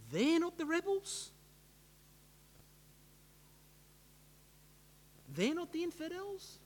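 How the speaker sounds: quiet, high rising questions; softly incredulous, long pauses between realisations; dawning disbelief. Acted. Saul learning he is the rebel, hushed disbelief. Acts out Saul's dawning horror.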